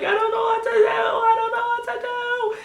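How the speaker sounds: very high hysterical wail, acted out; mock-hysterical high-pitched cry; freaking out. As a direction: re-enact total panic, squealing. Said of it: "I'm freaking out so much".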